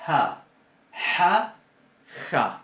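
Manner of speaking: "ja" dicho tres veces con fricciones distintas; tres sonidos ja. Shown as a demonstration. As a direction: compara tres "ja" cada vez más atrás. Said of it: "Voy a compararles". (tres "ja", más fuerte, contrastados)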